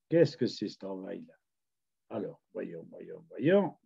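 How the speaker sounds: very soft and low, half under the breath; puzzled, private mumble while searching; muttering to himself. Real, not acted. Speaker hunting for the Facebook group, muttering to himself.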